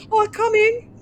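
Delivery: high, loud squeaky cartoon voice; character voice. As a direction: squeal it like a panicked cartoon figure. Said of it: "you'd see Getcha go".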